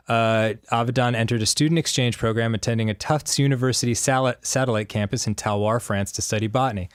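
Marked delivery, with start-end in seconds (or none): none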